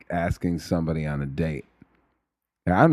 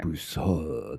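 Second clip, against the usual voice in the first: soft, trailing French take on Broussard; attempted French accent; 5 dB quieter than usual. Shown as a demonstration. Trying the French way of saying the surname, French accent. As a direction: have a clumsy go at French Broussard. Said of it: "I can't do it".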